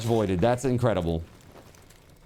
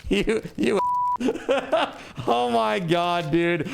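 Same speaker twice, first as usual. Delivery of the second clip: laughing; 9 semitones higher than usual. Real, not acted. Laughing after big donation.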